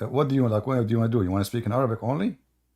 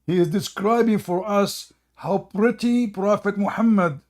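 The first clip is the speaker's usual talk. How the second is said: higher, slow, hammed-up awe; mock reverent; 7 semitones higher, 3 dB louder, slower than usual. Streamer narrating the video, mock reverent. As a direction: fake awe for laughs.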